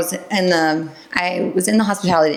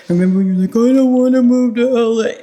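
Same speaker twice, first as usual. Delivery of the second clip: higher put-on teasing voice; exaggerated, raised-pitch mimicry of a spouse; mocking husband impression; 6 semitones higher, 4 dB louder than usual. Husband teases wife about moving to L A, mocking voice. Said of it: "that's how he says it".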